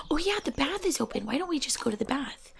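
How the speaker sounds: soft whispered suggestion. Acted. Gamer whispering her next move to viewers, soft whisper. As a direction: whisper like a cozy ASMR gamer.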